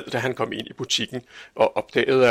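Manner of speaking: ordinary delivery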